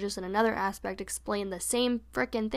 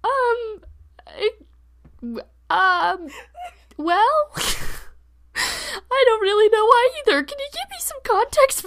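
much higher, louder, stammering and laughing; flustered mom; 12 semitones higher, 11 dB louder than usual. Retold or imitated. Mom lost for an answer, high and flustered. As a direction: fumble for words as the baffled mom. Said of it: "And she was like".

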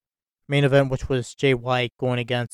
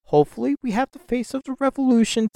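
proposing; 10 semitones higher, faster than usual. Real, not acted. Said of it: "I'll propose this".

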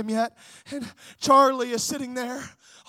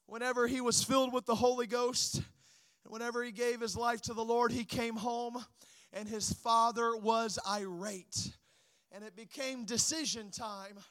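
soft, low, solemn pulpit storytelling; subdued sermon storytelling; 4 semitones lower, 7 dB quieter than usual. Real, not acted. Preacher recalling an evangelist's beginnings, subdued storytelling. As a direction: drop right down and begin the testimony quietly.

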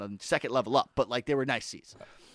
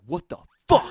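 loud disbelief; 8 dB louder than usual. Retold or imitated. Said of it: "I was just like".

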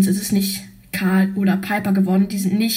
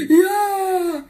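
high, loud happy yelp; excited cheer; 9 semitones higher, 4 dB louder than usual. Acted. Spike happy to share, high excited yelp. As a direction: cheer with a laugh.

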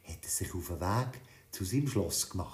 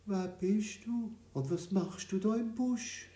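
lightly voiced old woman, higher; a gentle old woman wonders who hides in the bush; old woman asking; 11 semitones higher than usual. A narrator's reading. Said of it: "schaut den Bub an und fragt". (alte Frau, leicht höher gesprochen)

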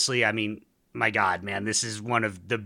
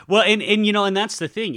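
laughing; 11 semitones higher, 8 dB louder than usual. Real, not acted. Host laughing at Borat joke.

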